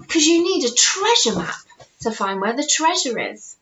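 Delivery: ordinary delivery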